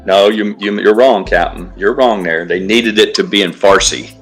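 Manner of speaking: normal talking voice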